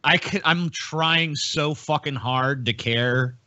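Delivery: ordinary delivery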